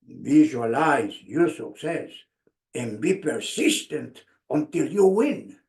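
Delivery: coach's wise advice. Retold or imitated. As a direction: recall the coach's words weightily. Coach's words remembered.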